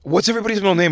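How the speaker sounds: high-pitched, put-on gushing surprise; mock amazement. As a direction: gush as if you had only just realized. Mock amazement at so many best friends.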